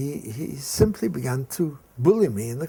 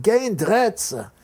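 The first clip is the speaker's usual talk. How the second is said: higher, louder, slow repeat of the answer; louder defiance; 8 semitones higher, 6 dB louder, slower than usual. Retold or imitated. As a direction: say it again, defiant and loud. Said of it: "That was Dutch courage".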